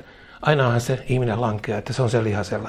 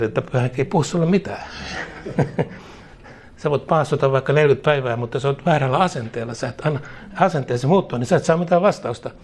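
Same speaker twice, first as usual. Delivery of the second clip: normal talking voice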